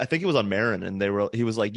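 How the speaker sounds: ordinary talk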